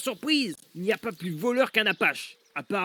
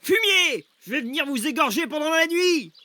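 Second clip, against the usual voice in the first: high, raised, raging threat; angry threat; 6 semitones higher, 3 dB louder than usual. Acted. Injured man threatening his enemies, angry yell. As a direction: hurl the threat out, pitched high.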